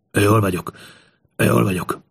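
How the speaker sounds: lightly voiced shaken old ruler; a hint of a weakened king; hurt old king. A narrator's reading. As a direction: brush off concern in a strained character voice. Hurt king reassures his helper.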